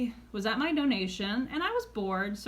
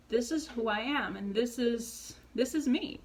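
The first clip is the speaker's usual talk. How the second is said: normal talking voice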